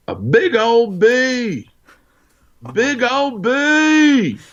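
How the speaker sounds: daughter's high, long-drawn holler; imitated yelling. Father imitating his daughter yelling from another room, high sing-out holler. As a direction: holler it high and stretch every word way out. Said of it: "she was yelling".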